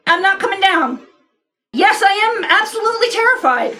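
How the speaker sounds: high, hammed-up nervous chirp; mock terrified. Hiding in the rafters from an angry man, mock terrified high voice. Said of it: "I go".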